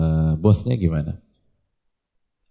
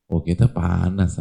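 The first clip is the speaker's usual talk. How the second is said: ordinary talk, nothing marked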